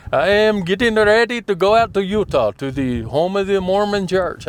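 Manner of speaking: high-pitched put-on Indian accent; imitated Indian accent. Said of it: "I'm gonna do the accent".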